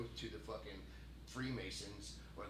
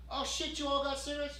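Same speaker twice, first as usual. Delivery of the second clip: high, loud, thrilled street greeting; excited surprise; 14 semitones higher, 7 dB louder than usual. Acted. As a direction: play someone amazed at free smokes. Acting out delighted homeless people.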